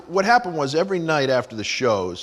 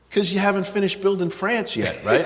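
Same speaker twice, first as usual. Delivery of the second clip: snapping back; 7 semitones higher than usual. Retold or imitated. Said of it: "finally I snapped".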